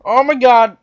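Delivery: startled shout. Real, not acted. Gamer reacting to breaking both legs, startled shout. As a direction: blurt it out at full volume. Startled cry at mishap in game.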